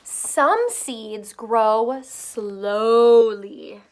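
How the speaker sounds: drawn-out, slow storybook reading; slow, loud read-aloud voice. Shown as a demonstration. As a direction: stretch each word out slowly for little ones. Reading slowly to children.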